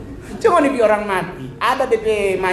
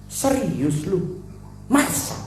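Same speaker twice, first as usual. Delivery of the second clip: line reading; 3 semitones higher, 4 dB quieter, slower than usual. Retold or imitated. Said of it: "D P dialog cuman".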